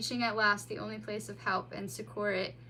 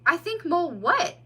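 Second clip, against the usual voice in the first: frustrated; 7 semitones higher, 8 dB louder than usual. A narrator's reading. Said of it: "asked the frustrated mole".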